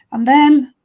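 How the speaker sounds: once-upon-a-time telling voice; storytelling. Acted. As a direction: tell it slowly to children, big on key words. Tells story to children.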